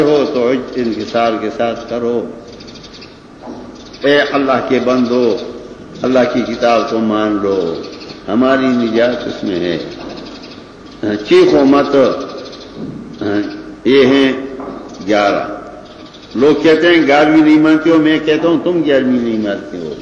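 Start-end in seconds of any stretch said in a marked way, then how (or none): none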